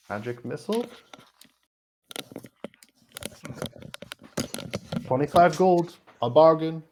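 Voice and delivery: high, loud hawker's pitch; eager shopkeeper. Acted. Shopkeeper to adventurer, loud eager sales pitch. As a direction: sell it like a pushy merchant. Shopkeeper NPC hawking scroll.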